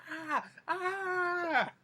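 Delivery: high imitated scream of panic; mock scream. Grandpa surrounded by zombies in his van, mock-terrified scream. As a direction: scream as if cornered, hammed-up. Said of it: "being surrounded, he's like".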